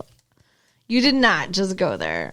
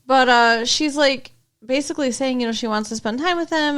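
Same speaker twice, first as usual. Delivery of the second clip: ordinary talk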